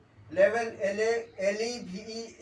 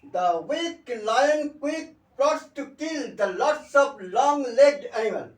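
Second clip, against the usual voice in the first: slow, emphatic tongue twister in one run; tongue twister demo; 3 semitones higher, 6 dB louder, much slower than usual. Shown as a demonstration. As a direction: recite it slowly and loudly, pitched up. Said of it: "এক্ষেত্রে আমরা tongue twister".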